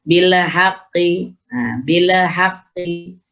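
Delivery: slow "Bila haqqi" with extra vowel after lam; wrong bouncing lam in "Bila haqqi"; tawallud. Shown as a demonstration. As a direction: let "Bil" spill into "bila". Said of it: "melebihkan suara dari huruf".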